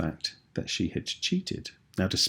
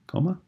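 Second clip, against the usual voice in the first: comma said aloud on a higher, rising pitch; spoken punctuation mark; 9 semitones higher than usual. Shown as a demonstration. Teacher showing pupils where the comma goes: comma spoken aloud. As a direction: lift the word comma up as a signal. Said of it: "I said the word comma deliberately".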